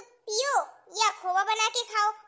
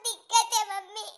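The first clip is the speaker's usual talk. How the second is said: crying, anguished plea; wounded daughter wailing to her mother; tearful outburst. Acted. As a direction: break down and demand an answer through tears. Daughter breaks down on the phone.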